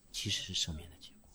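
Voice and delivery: 其实是赦免的结果 dropped low and soft, as a hushed reveal; quiet answer. Real, not acted. Preacher answering his own question, 其实是赦免的结果 in a low soft hush. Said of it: "到底是赦免的原因还是赦免的结果".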